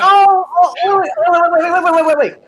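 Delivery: high, loud rapid waits; can't believe it, wait wait; surprised. Real, not acted. Stunned reaction to news.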